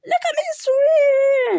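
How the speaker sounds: high, loud little squeak; squeaky Roo. A narrator's reading. Little Roo shows off his swimming, proud squeak. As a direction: squeal it with pride like a tiny joey.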